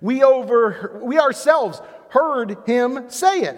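high, loud, slow triumphant accusation; indignant priests. Acted. Priests to the council, loudly triumphant. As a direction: nail him with the words. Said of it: "they said".

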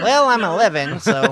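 put-on young girl voice; mock little girl. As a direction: answer as the eleven-year-old contestant. Voicing the girl contestant.

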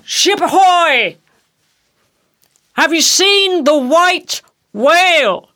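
high, drawn-out seaman's call; quarterdeck hail. Acted. Captain hailing a passing ship, long high hail. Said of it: "the quarterdeck hail was being heard".

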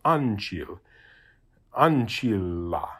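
ancilla said slowly, holding the double L; overemphasized double consonant. Shown as a demonstration. Teacher exaggerates for students how doubled consonants sound, ancilla with long LL. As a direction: stretch out both Ls in ancilla, syllable by syllable. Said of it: "I'm overemphasizing that".

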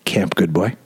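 name him flatly, like a knowing stranger; the friend's companion, low and dry; stranger recognizing him. Retold or imitated. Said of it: "she goes".

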